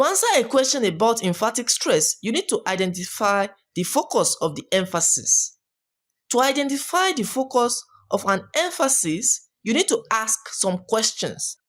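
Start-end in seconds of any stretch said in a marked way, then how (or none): none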